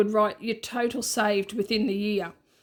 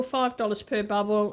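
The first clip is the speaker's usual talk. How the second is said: normal talking voice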